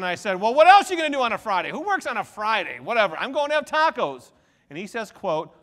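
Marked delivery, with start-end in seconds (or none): 0.4-4.3 fast, loud and cheeky, acting as his brother; rapid teasing evil-twin retort. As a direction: play the smug evil twin brushing him off. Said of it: "now the evil twin kicks in".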